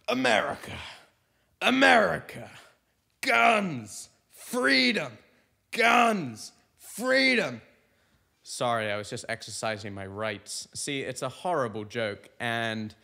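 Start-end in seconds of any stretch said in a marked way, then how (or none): 0.1-7.6 much higher, louder chant of slogans; hammed-up flag-waving shouting; over-the-top patriot bit. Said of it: "I'd just go on stage like this".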